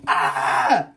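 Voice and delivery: sudden loud high "Ah!"; startled cry as the mouse moves; panicked high yelp. Real, not acted. Mouse jumping in box.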